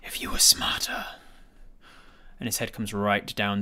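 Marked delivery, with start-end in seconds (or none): 0.0-1.1 sinister whispered dragon voice; menacing whisper. Acted. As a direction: hiss it low and threatening. Villain dragon speaks menacingly.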